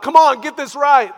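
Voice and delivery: loud, high exasperated shout; mock angry scolding. Preacher imitating the posture Jesus does not take, angry shout. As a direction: act out an impatient, angry demand at full volume. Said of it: "his posture in this is not like".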